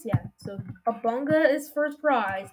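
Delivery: usual speaking voice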